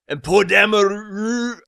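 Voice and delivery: ordinary delivery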